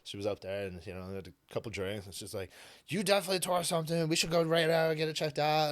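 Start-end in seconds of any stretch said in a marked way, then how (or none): 2.9-5.7 higher, insistent friend's voice; a hint of a pushy tipsy friend. Tipsy friend urging him at bar.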